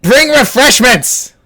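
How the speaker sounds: high, loud call across the house; calling a servant. Acted. Noble girl yells for her servant Gregor: loud call. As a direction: shout for the servant, imperious and bright.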